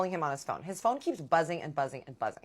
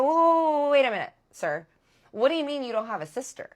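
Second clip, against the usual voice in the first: loud, much higher alarmed stop; higher, louder disbelieving whoa; shocked whoa; 7 semitones higher, 6 dB louder than usual. Retold or imitated. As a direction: slam the brakes on him, startled and loud. Catching his lie about sister.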